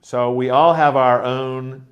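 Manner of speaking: ordinary talk, nothing marked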